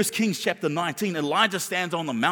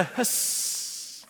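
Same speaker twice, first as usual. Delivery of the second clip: long drawn-out snake hiss; 3 semitones higher, 7 dB quieter than usual. Shown as a demonstration. Preacher shows the congregation a snake's sound, hissing. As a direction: draw out the final s like a snake. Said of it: "What sound does a snake make?".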